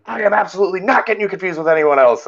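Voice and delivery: high-pitched imitation of an irate woman; mock outrage. Angry lady rejects the mistaken identity idea, mock outrage. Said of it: "she's losing it".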